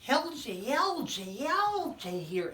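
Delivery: high, loud squeaky puppet. Acted. Puppet friend greeting the children, high and loud. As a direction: pop up cheerfully as a puppet.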